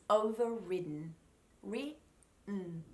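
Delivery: "overridden" then broken into "ri-, mm"; "overridden" with no last vowel; silent D. Shown as a demonstration. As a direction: hold the D of "overridden" into a hummed N. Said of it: "Okay, silent D".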